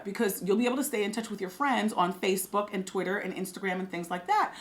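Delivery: normal talking voice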